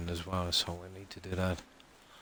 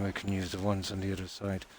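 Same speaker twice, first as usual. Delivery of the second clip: normal talking voice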